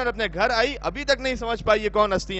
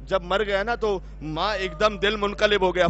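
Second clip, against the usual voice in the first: usual speaking voice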